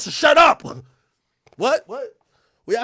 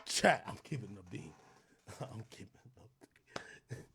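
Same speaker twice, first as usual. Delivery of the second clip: quiet laughing; 20 dB quieter, faster than usual. Real, not acted. Laughing at own joke.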